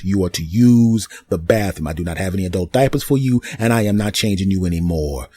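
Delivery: ordinary talk, nothing marked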